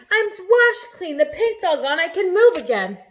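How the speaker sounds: high, loud, slow joyful cry; overjoyed. Acted. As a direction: exult at being free. Centipede freed, overjoyed.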